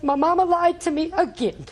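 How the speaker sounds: put-on high, tearful child's voice, words breaking with hurt; crying granddaughter. Grandmother voicing her crying granddaughter, heartbroken and tearful. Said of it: "she was crying so hard".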